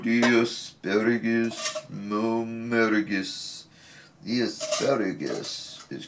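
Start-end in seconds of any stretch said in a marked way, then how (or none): 0.0-1.8 asparagus said in a playful stretched voice; silly put-on voice. 1.9-3.6 playful sing-song put-on voice, stretching the word; silly food voice.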